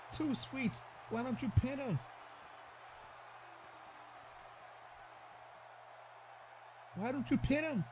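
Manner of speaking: high, eager plea to the wrestler; urging pin. Real, not acted. Commentator urging A J to pin, high and eager. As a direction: beg him to go for the cover.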